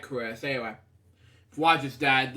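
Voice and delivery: plain reading voice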